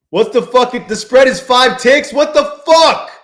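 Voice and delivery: loud, high outburst at the screen; real exasperated shouting; frustrated yell. Real, not acted. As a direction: yell at the chart in disbelief. Frustrated trader yelling at chart.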